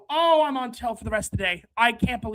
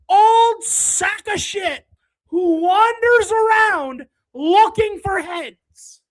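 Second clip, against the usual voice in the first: loud, slow, drawn-out scorn; slow, loud, disgusted ridicule; mocking rant; 6 semitones higher, 5 dB louder, slower than usual. Real, not acted. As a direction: drag each insult out. Streamer mocking player's defense.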